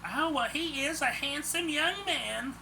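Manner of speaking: put-on high, slow old-lady voice; smiling elderly lady. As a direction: admire him with a smile in the voice. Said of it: "the lady said with a smile".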